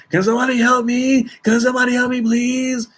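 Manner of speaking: high, begging plea, not quite a scream; pleading for help. Retold or imitated. Passenger pleading over and over for help, high begging voice. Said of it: "He just kept saying over and over".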